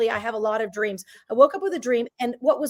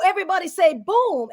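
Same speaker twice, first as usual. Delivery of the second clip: mock stage call; 7 semitones higher, 4 dB louder than usual. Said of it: "I go".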